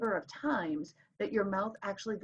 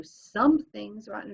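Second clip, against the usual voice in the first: "SOMEthing's rotten", first syllable much louder; testing the stress; 2 semitones higher, 9 dB louder, slower than usual. Shown as a demonstration. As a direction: punch "Some" in "Something's rotten", slowly. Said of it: "listen to the way you say it".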